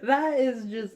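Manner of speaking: high, loud words through laughter; amused amazement; laughing. Real, not acted. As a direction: gush while still laughing. Cracks up in amazement.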